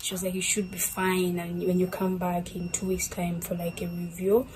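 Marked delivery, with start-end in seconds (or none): none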